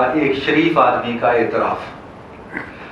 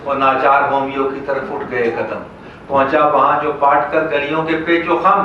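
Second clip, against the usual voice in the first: raised, rhythmic mushaira declamation; comic poem recital; 4 semitones higher, 4 dB louder than usual. Acted. Poet recounting a patient's hunt for doctors, declaimed. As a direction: declaim each rhymed line out to the hall.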